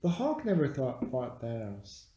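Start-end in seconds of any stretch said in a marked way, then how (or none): none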